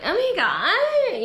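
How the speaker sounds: high, very slow gushing "Oh my God"; mock beach girl.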